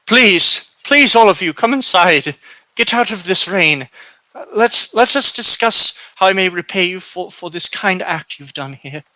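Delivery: put-on high, pleading old-woman voice, easing down at the end; grateful old woman. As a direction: urge them in as a grateful old hostess.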